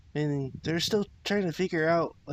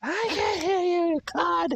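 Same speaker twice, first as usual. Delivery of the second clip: very high, strained mock shout; Peridot yelling; 4 dB louder than usual. Friend joins in as gong-deafened Peridot, high mock yelling. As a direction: yell it like an exasperated cartoon character. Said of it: "more yelling from Peridot".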